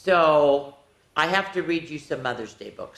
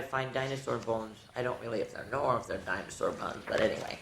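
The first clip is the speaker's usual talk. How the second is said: much lower and softer, trailing; mumbled aside; 6 semitones lower, 10 dB quieter, faster than usual. Real, not acted. Teacher unsure about the dinosaur bones, mumbling aside. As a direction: mumble it to yourself.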